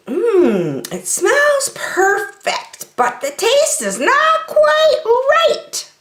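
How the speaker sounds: high, slow duck voice savouring soup; a squeaky little chef tasting his soup; thoughtful cartoon duck; Max the duck voice. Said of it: "said Max".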